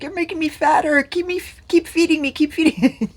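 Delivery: high, fast, whining plea to be fed; mock whiny self. Teasing her husband, she whines in a high mock voice. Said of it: "it's like".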